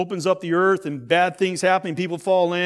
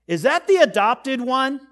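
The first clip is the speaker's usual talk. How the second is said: higher, rising question as the relative; pointed retold question; 6 semitones higher, 4 dB louder than usual. Relative asks about the child running through the kitchen, pointed question. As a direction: imitate a relative asking aloud, pitched up.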